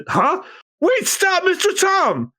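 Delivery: very high frantic plea; alarmed apprentice. Acted. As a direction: shout at Tom to stop.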